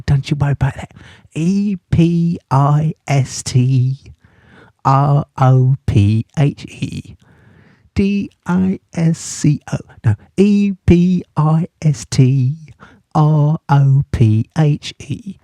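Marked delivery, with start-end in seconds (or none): 1.3-7.2 slow, letter-by-letter spelling. Shown as a demonstration. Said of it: "Shall I spell it for you?". 7.9-15.4 slow, playful letter-by-letter spelling. Shown as a demonstration. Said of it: "Shall I spell it for you?".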